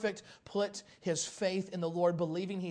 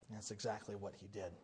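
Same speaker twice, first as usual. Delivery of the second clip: usual speaking voice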